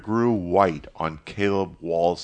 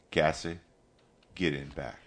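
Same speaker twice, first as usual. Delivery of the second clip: quiet instruction, softer at the end; 6 dB quieter than usual. A narrator's reading. Mister Morrison sending Cassie to the back, very quietly. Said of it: "Very quietly, Mister Morrison said".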